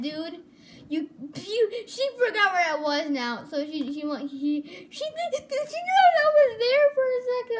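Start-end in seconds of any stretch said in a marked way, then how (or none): 4.9-7.6 much higher, louder startled cry. Real, not acted. Player spotted by the murderer, startled high cry. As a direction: yelp it out in alarm.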